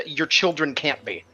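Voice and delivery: ordinary talk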